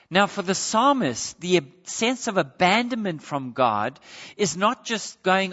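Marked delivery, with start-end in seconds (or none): none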